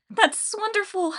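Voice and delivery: high, strained fake cheer; forced enthusiasm. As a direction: push out enthusiasm you don't feel. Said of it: "Hazel tried to sound enthusiastic".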